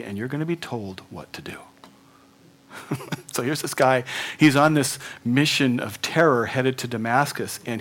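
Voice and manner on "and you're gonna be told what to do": whisper. Pretend. The Lord's quiet instruction.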